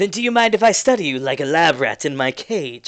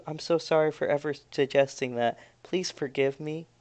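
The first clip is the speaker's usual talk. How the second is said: much softer, shrinking apology; timid apology; 9 dB quieter than usual. Acted. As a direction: whimper it out, cowed. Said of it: "gets timid and says".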